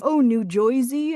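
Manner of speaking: mock thick New Jersey accent, Joisey. Teasing how people hear New Jersey, in a mock Jersey accent. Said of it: "not everybody's like always".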